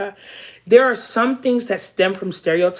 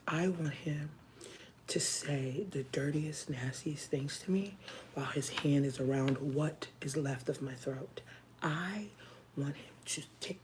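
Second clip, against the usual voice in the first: sultry whisper; 13 dB quieter than usual. Real, not acted. Fantasizing about the podcast host, in a sultry whisper. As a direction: murmur it like a secret fantasy.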